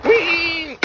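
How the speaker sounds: high, loud whee; gleeful whoop. Acted. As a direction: whoop as you launch into a stunt. Launching into the stunt.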